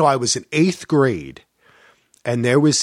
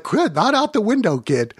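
mock scolding; 10 semitones higher than usual. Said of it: "I'll be like".

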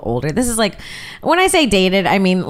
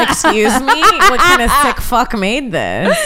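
outraged, slow; mock outrage; 7 semitones higher, 5 dB louder, slower than usual. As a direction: play scandalised at the letter. Mock outrage at cursive letters.

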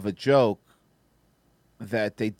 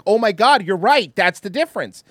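excited realization; 7 semitones higher, 7 dB louder than usual. Retold or imitated. Retold own realization, excited.

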